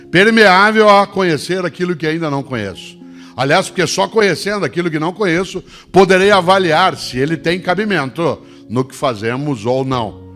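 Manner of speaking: high, loud rousing call to the audience; booming motivational speech. Acted. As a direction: lift the crowd with a big appeal. Emphatic point about humility.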